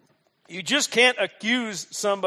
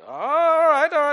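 higher and louder, hammed-up enthusiasm; eager agreement; 9 semitones higher, 9 dB louder, slower than usual. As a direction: cheer the idea on like a keen crony. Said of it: "Now, I'm gonna use accents".

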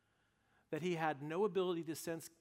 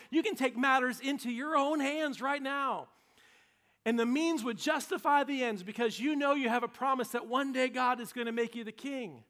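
louder, higher, insistent urging; urgent pressing; 8 semitones higher, 10 dB louder than usual. Acted. David's men pressing him to kill Saul, loud and urgent. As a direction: push him hard to seize the moment.

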